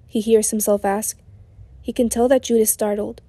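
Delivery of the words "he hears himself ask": plain reading voice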